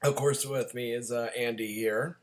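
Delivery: hoarse from a cold; husky, scratchy throat; raspy voice. Real, not acted. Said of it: "sorry about the raspy voice".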